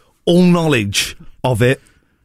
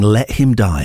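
lower, eerie spooky intonation; mildly spooky old woman; ghost of an old woman; 6 semitones lower than usual. Retold or imitated. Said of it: "the ghost of an old woman saying".

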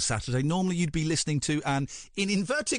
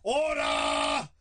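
shouting order order. Pretend. Mock Commons order call.

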